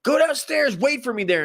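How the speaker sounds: high, barked order from a drill instructor; high-pitched mock command sending the recruit downstairs. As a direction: snap out the order like a drill sergeant. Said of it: "he tells you to get out".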